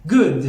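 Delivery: short GOOD, neither full oo nor o; GOOD with in-between vowel. Shown as a demonstration. Teacher models the exception, GOOD between oo and o. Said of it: "یک صدای استثنا هست در بین".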